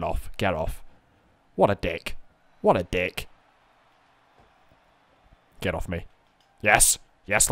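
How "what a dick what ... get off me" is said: ordinary talk, nothing marked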